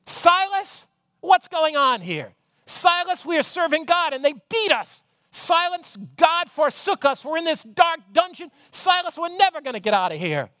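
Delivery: Paul panicking in the dungeon, pleading; high, fretful cries of Silas' name; despairing Paul. Acted. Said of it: "And Paul looks over at Silas and says".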